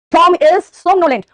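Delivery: slow and loud, each word held for the class; a teacher drilling a new word; slow emphatic example. Shown as a demonstration. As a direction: announce the example sentence slowly. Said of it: "What is somnolent?".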